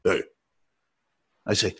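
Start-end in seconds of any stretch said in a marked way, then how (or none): 0.0-0.2 unimpressed star's reply. Retold or imitated. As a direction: imitate a star who can't be bothered. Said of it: "He goes".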